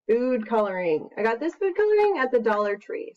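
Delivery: ordinary delivery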